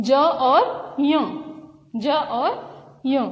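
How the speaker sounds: ja and nya said very slowly; slow letter naming. Shown as a demonstration. Teacher naming the two letters slowly. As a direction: spell ज और ञ out slowly. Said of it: "ज्यों में कौन से वर्ण हैं".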